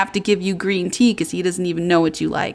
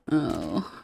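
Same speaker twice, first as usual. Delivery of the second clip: normal talking voice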